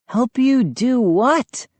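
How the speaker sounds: high, slow, insistent question; demanding. A narrator's reading. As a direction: press them for an answer. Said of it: "I demanded".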